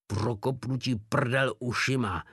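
plain reading voice

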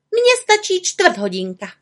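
high, headstrong little witch; pig-headed high-pitched retort; stubborn. A narrator's reading. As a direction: answer obstinately in a high voice. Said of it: "odpověděla malá čarodějnice paličatě".

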